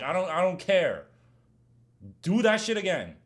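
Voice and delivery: loud, high, ranting warning; worked-up, full-volume trash talk; heated. Real, not acted. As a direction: shout a threat at everyone who fouls you. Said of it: "that's why I'm so heated about it".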